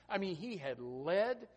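ordinary talk

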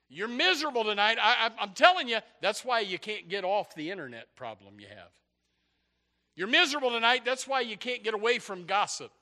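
impassioned sermon pitch; forceful preaching. Real, not acted. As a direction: drive it home to the congregation, high and loud.

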